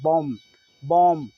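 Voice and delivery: "बॉम्ब" repeated as "bom", ending on M; silent B. Shown as a demonstration. Teacher drills the correct reading, "बॉम्ब" with silent B. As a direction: say "बॉम्ब" twice without the final B. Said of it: "पढ़ा जाता है".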